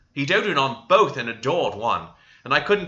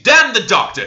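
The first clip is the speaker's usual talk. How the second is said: angry outburst; 9 semitones higher, 9 dB louder than usual. A narrator's reading. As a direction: cut in with a higher, louder furious shout. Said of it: "he interrupted, reddening".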